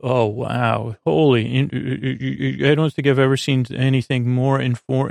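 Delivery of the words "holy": normal talking voice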